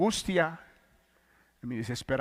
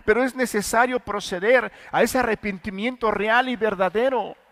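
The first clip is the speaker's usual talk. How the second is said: louder, raised preaching voice pleading for repentance; fervent preaching; 7 semitones higher, 8 dB louder than usual. Acted. A preacher urging his listeners toward true repentance, fervent and loud. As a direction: preach it out, urgent and insistent.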